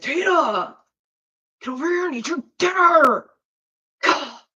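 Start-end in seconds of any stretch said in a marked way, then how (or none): 0.0-3.3 lightly voiced movie yell; a hint of a whiny shout; Napoleon Dynamite quote. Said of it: "'cause Napoleon Dynamite".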